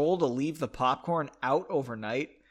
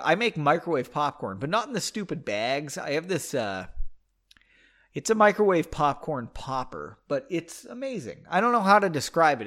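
normal talking voice

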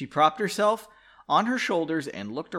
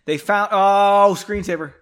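startled oh; 5 semitones higher, 9 dB louder than usual. Real, not acted. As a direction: caught off guard mid-sentence. Reader startled by screensaver.

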